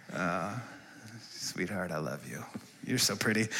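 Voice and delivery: tender aside away from the mic; hushed aside. Real, not acted. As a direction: drop to a near-hush for your daughter. Aside to daughter mid-sermon.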